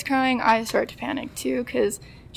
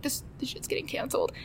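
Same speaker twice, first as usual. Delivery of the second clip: hushed dread; 3 semitones higher, 9 dB quieter, faster than usual. Retold or imitated. No gate, sure flight canceled.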